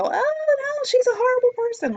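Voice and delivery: high, loud mock gasp of dismay; mock shocked listener. Mocking viewers who think she's horrible when she yells, high shocked whine. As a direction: parody someone clutching pearls. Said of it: "you're gonna go".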